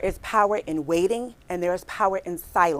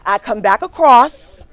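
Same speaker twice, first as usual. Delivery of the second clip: ordinary delivery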